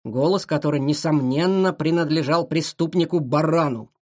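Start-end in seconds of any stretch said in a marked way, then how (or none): none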